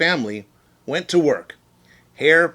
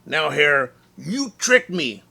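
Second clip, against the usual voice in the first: indignant bear; 5 semitones higher, 5 dB louder than usual. Acted. Bear accusing Hare of trickery.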